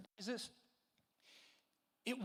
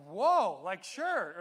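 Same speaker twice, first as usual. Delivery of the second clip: startled, louder whoa; 11 dB louder, much slower than usual. Retold or imitated. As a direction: caught off guard, blurting it. Startled by the yell.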